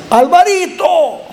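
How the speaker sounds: high, drawn-out call of the name; grandmother calling. Retold or imitated. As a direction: imitate a grandmother calling a child from afar. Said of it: "oí una voz que decía". (imitando a la abuela que llama, agudo y alargado)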